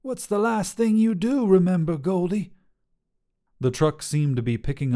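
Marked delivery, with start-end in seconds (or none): 0.0-2.5 higher, amused trucker woman voice; teasing trucker. Acted. Trucker Sharon teasing Goldie, amused and higher. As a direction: needle him with a hint of a laugh.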